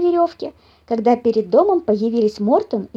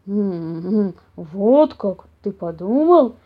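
slow, musing bear voice; hum, then ask in slow wonder; mildly surprised bear; 3 semitones lower, much slower than usual. A narrator's reading. Papa bear hearing the mouse's idea, surprised. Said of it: "удивился папаша Бамсе".

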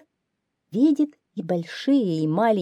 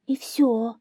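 high little hare voice; hare's answer; 6 semitones higher than usual. A narrator's reading. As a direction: admit it as a timid hare. (заяц отвечает тонким голосом)

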